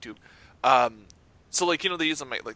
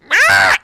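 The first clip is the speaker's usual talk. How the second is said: sudden high loud mock squawk; a loud, high bird call mocking the kid; gull cry; 13 semitones higher, 15 dB louder than usual. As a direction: screech like the thieving gull. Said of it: "looks at me, goes".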